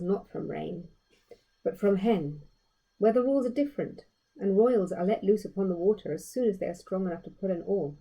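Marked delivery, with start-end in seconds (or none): none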